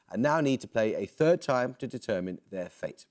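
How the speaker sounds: normal talking voice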